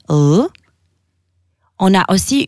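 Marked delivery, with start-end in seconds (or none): 0.1-0.4 l dark, as at the end of "call"; isolated heavy l; Albanian LL sound. Shown as a demonstration. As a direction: say l as English dark l. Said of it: "comme call en anglais".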